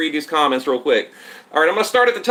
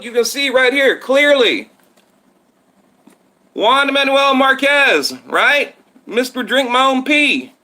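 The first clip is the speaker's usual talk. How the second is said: excited yelling; 6 semitones higher than usual. Real, not acted. Telling viewers about the Marquez knockout, real excited yelling. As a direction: get worked up over the replay, almost shouting. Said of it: "Why am I yelling?".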